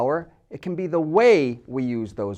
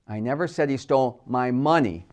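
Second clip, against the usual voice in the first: "money" punched at the end; stress on MONEY; 3 semitones lower than usual. Shown as a demonstration. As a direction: save the weight for the final word "money". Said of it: "simply stressing different words".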